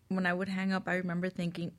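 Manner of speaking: ordinary delivery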